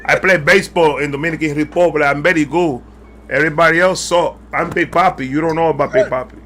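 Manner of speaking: put-on a light Dominican-accented English, as David Ortiz. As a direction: playfully pose as the Dominican slugger in a lightly done Dominican accent. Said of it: "I can't do the accent".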